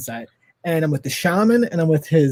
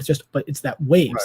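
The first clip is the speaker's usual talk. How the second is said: ordinary delivery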